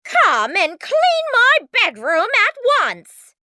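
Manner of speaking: high, loud stepmother's command; imperious high-pitched yell; shouted order. A narrator's reading. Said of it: "shouted her stepmother".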